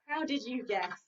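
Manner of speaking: ordinary delivery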